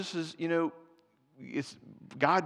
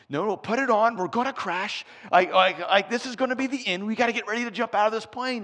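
mock panic; 7 semitones higher, 6 dB louder, faster than usual. Preacher acts panicked plane passenger.

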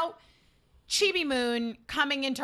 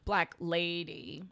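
lady drawn out slowly; stress on lady; 8 semitones lower, 6 dB quieter, slower than usual. Real, not acted. Clarifying the character is grown up, stress on lady. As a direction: lean slowly on the word lady. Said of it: "Emphasis on the lady".

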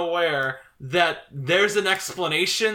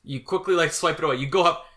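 ordinary talk, nothing marked

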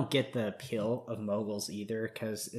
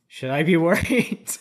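nervous laugh breaking through a louder, higher question; laughing worry; 6 semitones higher, 11 dB louder than usual. Retold or imitated. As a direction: ask it half laughing at your own nerves. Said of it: "I was like".

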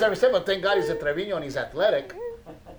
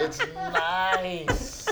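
giggling through the words, high; laughing. Real, not acted. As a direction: laugh it out, a bit guilty. Laughing at guilty pleasure.

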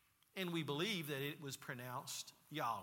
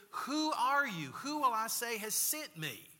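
higher, louder, urgent questioning; Moses questioning; 6 semitones higher, 7 dB louder than usual. Retold or imitated. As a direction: call it out in a raised voice. Acting Moses questioning God at bush.

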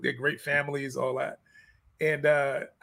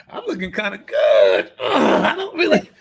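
higher, louder wheedling woman, a touch of a laugh; mock tempted woman; 4 semitones higher, 7 dB louder, slower than usual. Mimicking a client tempted to cheat on her diet, high and loud. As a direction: play the dieter talking herself into a cheat.

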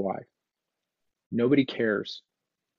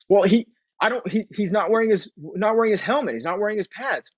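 much higher, flustered stammering parent; worked-up parent; 11 semitones higher than usual. Retold or imitated. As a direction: defend yourself, rattled and high. Parent answering why they yelled.

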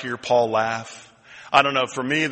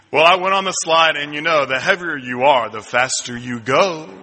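normal talking voice

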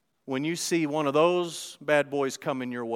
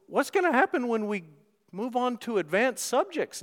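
higher, louder mock-professor needling; teasing jab; 8 semitones higher, 5 dB louder than usual. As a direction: prod the student, higher and louder, half teasing. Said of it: "a bit of a jab".

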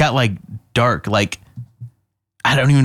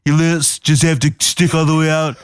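Trump impression; 4 semitones higher than usual. Shown as a demonstration. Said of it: "It's all about the lips".

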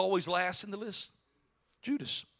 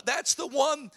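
usual speaking voice

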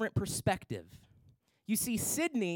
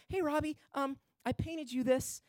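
put-on soft, sweet little girl asking shyly; lightly voiced small child, softer; 4 semitones higher, 6 dB quieter than usual. Voicing little Sydney sweetly.

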